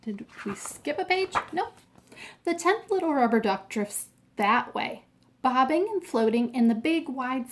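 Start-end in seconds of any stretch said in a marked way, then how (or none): none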